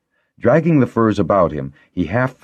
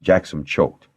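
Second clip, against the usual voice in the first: ordinary delivery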